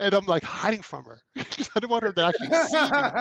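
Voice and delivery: real amused laughter breaking into the words; laughing while telling. Real, not acted. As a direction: laugh it out, embarrassed. Hiding from [name], laughing.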